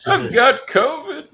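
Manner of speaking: mock sick voice.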